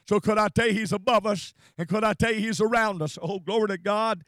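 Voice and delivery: loud, driving sermon delivery; full-force preaching. Real, not acted. Preacher assuring friends God is around us, fervent preaching. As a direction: build in repeated phrases, proclaiming each one.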